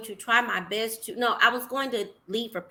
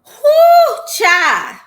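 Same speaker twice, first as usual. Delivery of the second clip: loud, high whoop of agreement; excited whoop; 10 semitones higher, 14 dB louder than usual. Real, not acted. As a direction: shout your delight at the word. Reaction to word peace.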